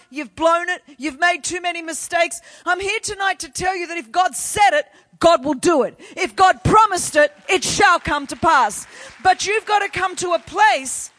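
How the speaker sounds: forceful sermon building to a loud declaration; impassioned preaching. Real, not acted. Preacher reviving a church's dead promises, impassioned and building. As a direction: build from insistent to thundering conviction.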